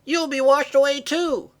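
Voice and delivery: high-pitched, loud cry of warning; urgent warning shout. Acted. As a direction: shout to stop someone going out. Sister shouts warning in storm.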